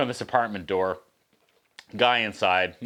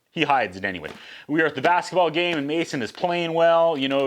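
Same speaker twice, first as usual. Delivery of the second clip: ordinary talk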